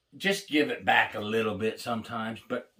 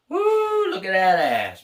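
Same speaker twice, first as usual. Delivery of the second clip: inmate catcall; 7 semitones higher, 6 dB louder than usual. Retold or imitated. Inmates catcalling college girls.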